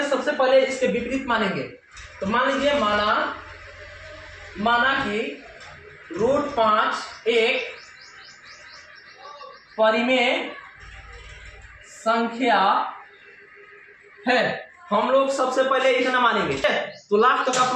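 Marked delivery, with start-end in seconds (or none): 2.8-14.7 word by word, long pauses between; slow dictation. Real, not acted. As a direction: dictate slowly as you write it out. Dictating while writing out the proof.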